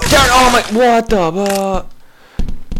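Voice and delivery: high, loud startled yelp trailing slowly; startled outburst. Real, not acted. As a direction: burst out in shock. Sudden death in game.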